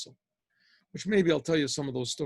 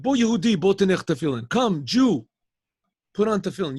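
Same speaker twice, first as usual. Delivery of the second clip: loud call to a passerby; 3 semitones higher, 6 dB louder than usual. Retold or imitated. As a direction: call out louder, pressing him to stop. Calling the passing man.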